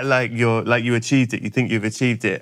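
usual speaking voice

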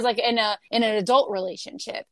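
ordinary talk, nothing marked

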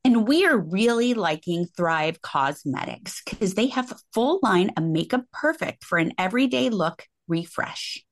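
ad read. Real, not acted. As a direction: read the makeup copy gently to listeners. Reads a sponsor ad.

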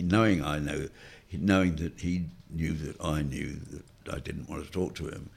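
ordinary delivery

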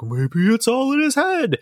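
high, slow, sing-song mockery; mock the cliché in a high drawn-out voice; lightly mocking a tired twist; mocking lame line. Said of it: "the lame".

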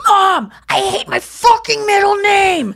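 high, loud, drawn-out mock yell; parakeet mimicking its owner. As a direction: squawk out a whiny complaint to Mom, slowly. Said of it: "in your voice, Nikki".

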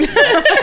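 high, loud burst of laughter; real laugh. Real, not acted. Storyteller trying not to cry, sudden high laugh. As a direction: laugh out loud through the emotion.

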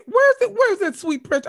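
high sing-song coo for a pet; high doting baby-talk voice. Retold or imitated. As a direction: coo like asking after a sweet baby. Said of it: "Every time somebody DMs me".

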